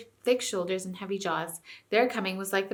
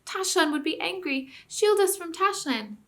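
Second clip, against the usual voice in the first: whimpering; 9 semitones higher than usual. A narrator's reading. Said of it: "whimpered dozens of voices".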